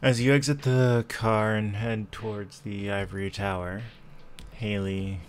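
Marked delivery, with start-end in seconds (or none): none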